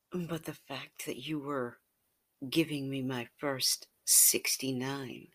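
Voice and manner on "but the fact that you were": plain reading voice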